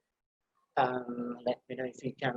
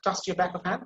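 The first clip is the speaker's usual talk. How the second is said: normal talking voice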